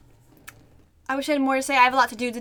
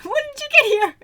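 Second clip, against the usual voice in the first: excited; 9 dB louder than usual. Real, not acted. Excited at character's arrival.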